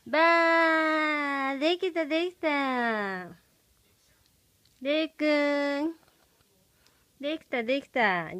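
fast, loud happy cheer; excited cheer. Real, not acted. Peekaboo cheer to baby.

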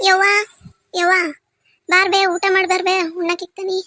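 loud call to come and eat. Real, not acted. Son calling his mother in to eat, loud call. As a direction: call across the house to mother.

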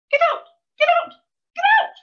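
very high, loud squeak; squeaky shriek. A narrator's reading. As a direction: squeal it like a cat with a trodden-on tail. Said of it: "Her voice grew squeakier".

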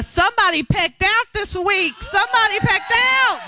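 high-pitched, jubilant shout; building to a high holler for the crowd; excited shout. Real, not acted. Announcing news to the Lighthouse women, jubilant shout.

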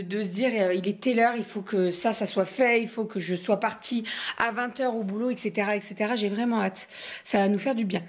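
usual speaking voice

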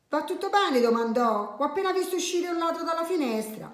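high, urgent acted concern; alarmed question. Neighbour rushes into the kitchen, alarmed high question. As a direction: ask it urgently, pitched right up.

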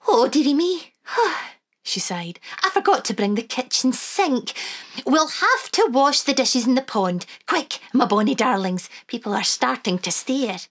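put-on high, lilting old Scottish granny; Wee Granny voice. Granny to the children, high and fond.

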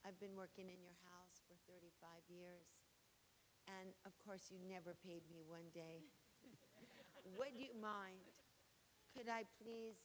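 a lightly voiced, soft and timid servant asking; polite servant. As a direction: ask humbly, almost apologetically. Servant asking mistress for gold.

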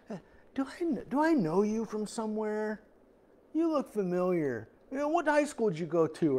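a hint of a nosy stranger; puzzled stranger. Acts out a squinting stranger's recognition.

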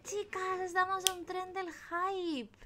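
very high, singsong squeal; excited shout-out. Real, not acted. Streamer thanks a subscriber and hails hype train, squealing singsong. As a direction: stretch the name and thanks out high.

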